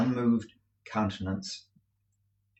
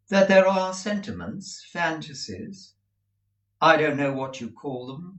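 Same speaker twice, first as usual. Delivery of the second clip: put-on cool, detached young woman voice; calm and cold; 6 semitones higher, 3 dB louder than usual. A narrator's reading. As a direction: say it coolly, untouched. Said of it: "said Estella very calmly".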